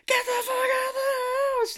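very high, strained mock shriek; hammed-up panicked screech; imitated phone scream. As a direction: scream a warning like someone down the line. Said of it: "hear her on the other line".

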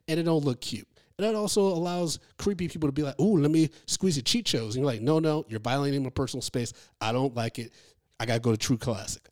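upbeat host sponsor read. Acted. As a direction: sell it like a podcast ad. Reads sponsor ad.

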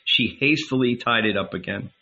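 plain reading voice

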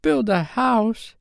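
high, drawn-out disbelieving question; slow high-pitched incredulity; incredulous exclamation. A narrator's reading. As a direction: exclaim in astonishment. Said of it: "exclaimed John".

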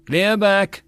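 high, loud call of "Clear back!"; yelled warning. A narrator's reading. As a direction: bark the backblast warning. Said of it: "and he yelled".

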